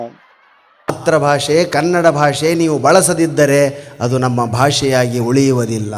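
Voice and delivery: slow, loud, low-pitched oratory; solemn declamation. Real, not acted. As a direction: declaim it as a grave public warning. Solemn warning declaimed to audience.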